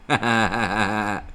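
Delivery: loud staccato "ha ha ha"; gloating laugh. Acted. Host after winning the teeth war, gloating laughter. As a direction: laugh like a boastful winner.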